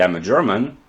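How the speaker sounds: ordinary talk, nothing marked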